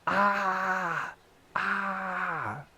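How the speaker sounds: high, pained ah-ah; mock cry of pain; pained yelp. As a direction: yelp like the elbow hurts. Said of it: "He go".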